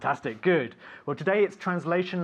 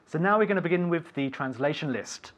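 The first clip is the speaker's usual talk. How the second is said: ordinary talk, nothing marked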